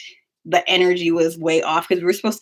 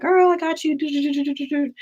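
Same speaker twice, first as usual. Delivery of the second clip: higher, breezy and casual; breezy reassurance; 9 semitones higher than usual. Retold or imitated. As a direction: wave off her worries, breezy.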